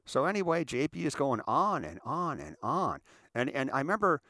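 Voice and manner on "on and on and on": slow, looping repeats of "on"; drawn-out singsong tedium; droning on. Retold or imitated. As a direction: drag out each "on" in "on and on and on". Mimics endless rambling.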